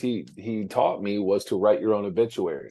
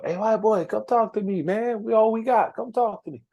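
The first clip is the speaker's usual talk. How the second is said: much higher, friendly calling down the hallway; coaxing mentor call; 13 semitones higher than usual. Retold or imitated. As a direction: pitch way up and coax him over. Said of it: "every day he would still".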